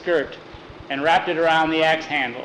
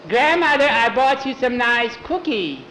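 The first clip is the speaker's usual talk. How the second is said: play-acted little girl; 7 semitones higher than usual. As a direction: declaim it in a girlish voice.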